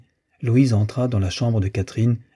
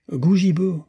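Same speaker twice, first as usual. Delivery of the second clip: exclaimed; 8 semitones higher, slower than usual. A narrator's reading. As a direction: call out sharply higher, as a captain would. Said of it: "s'écria le capitaine". (exclamation aiguë de capitaine)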